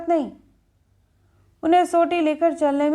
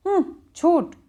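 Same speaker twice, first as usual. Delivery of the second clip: put-on child's lisping talk; 5 semitones higher than usual. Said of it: "इसकी तोड़ती बोली".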